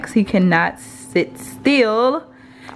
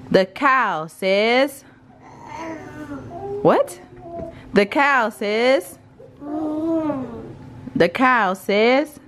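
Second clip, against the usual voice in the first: slow, drawn-out rising prompt; sing-song prompt; 6 semitones higher, much slower than usual. Real, not acted. Prompting baby for animal sounds.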